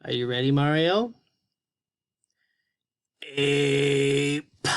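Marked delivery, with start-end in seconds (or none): 3.2-4.7 puppet sounding out. Pretend. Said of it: "Listen to his sounds".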